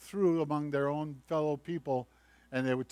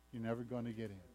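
much softer, lower, sinking repeat; quiet repeat; 5 semitones lower, 13 dB quieter, much faster than usual. Acted. Preacher repeats the warning to the congregation, softly. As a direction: let the warning drop almost to a hush.

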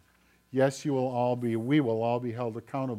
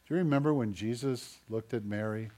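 ordinary delivery

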